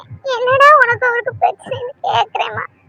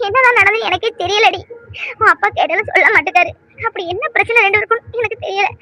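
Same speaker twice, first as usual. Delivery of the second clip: ordinary delivery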